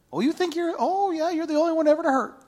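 high, fast, sarcastic taunt; sarcastic mockery. God teasing the self-pitying prophet, high and sarcastic. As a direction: needle him with mock sympathy. God mocking whiny Elijah.

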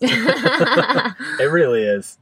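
slow, through laughter; laughing. Real, not acted. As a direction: laugh it out, drawn long. Laughing agreement.

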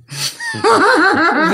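high bright burst of laughter; shy laugh. Real, not acted. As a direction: laugh it off, a little embarrassed. Laughs at calling text erotic. (γέλιο με αμηχανία)

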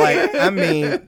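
laughing. Real, not acted. Laughing excitedly over the memory.

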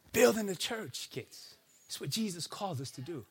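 soft aside. Acted. Pastor answering his kids about building the church, soft. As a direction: drop it down, gentle.

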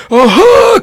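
loud, high-pitched "aha" yell; triumphant cry. Acted. Henry charges at his bro, crying out loudly. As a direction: cry out as if pouncing. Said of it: "cried Henry to his bro".

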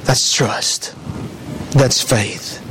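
low, near-whispered conviction; hushed emphasis. Real, not acted.

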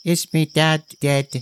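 put-on a boy fearing the answer. A narrator's reading. Boy to the prince, lightly voiced anxious child. Boy asking about father.

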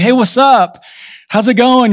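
high, breezy phone greeting; imitated chummy caller. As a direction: play a friend calling out of the blue. Voices a friend phoning unannounced.

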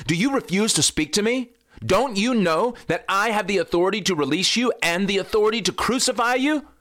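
high, rapid interrogation; demanding Pilate. Acted. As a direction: press Jesus for an answer as Pilate. Pilate confronting silent Jesus.

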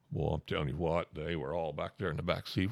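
usual speaking voice

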